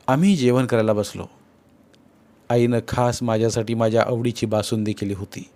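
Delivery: ordinary talk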